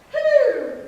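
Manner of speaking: high, loud called hello with a laugh; calling hello. Shown as a demonstration. Coach playing a game with the chorus, calling out. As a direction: call out hello playfully, high up. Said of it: "let's play this game".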